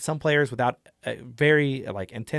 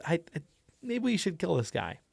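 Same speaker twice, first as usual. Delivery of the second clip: hesitant, stammering start, higher pitched; falter, then offer it timidly, higher; nervous character; 5 semitones higher, 4 dB quieter than usual. Shown as a demonstration. Anxious player character suggesting they kill someone, nervous. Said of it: "No voice, but definitely di-, two different people".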